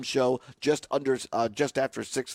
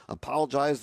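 ordinary delivery

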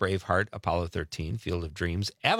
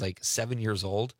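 ordinary talk, nothing marked

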